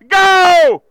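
loud, high "Go!"; exclamation point shout. Acted. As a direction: fire it off like a command. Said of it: "with an exclamation point".

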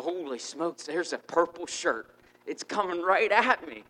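loud, rising alarm at a sighting; startled excitement. Retold or imitated. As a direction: build to a high, loud exclamation. My heart just starts pounding.

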